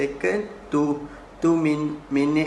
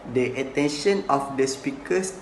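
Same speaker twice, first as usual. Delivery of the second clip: usual speaking voice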